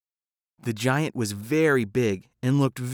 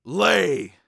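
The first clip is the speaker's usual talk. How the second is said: loud, high bark of an order; shouted command; 7 semitones higher, 6 dB louder than usual. A narrator's reading. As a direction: order the hen to lay. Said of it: "He shouted".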